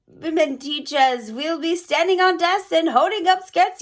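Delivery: Grand High Witch gloating to the crowd. Acted.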